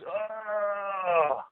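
long, high, held-back yell; high drawn-out mock roar, kept soft; imitated battle cry. Caller imitating Wolverine with his claws out, soft mock battle cry. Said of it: "and he goes".

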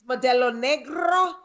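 playful Spanish-accented beer name. Real, not acted. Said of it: "with my ethnic alcohol accents".

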